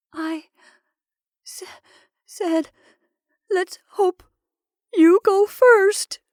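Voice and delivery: high, breaking sob, slowing down; sobbing. Acted. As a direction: sob out the words. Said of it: "sobbed his wife".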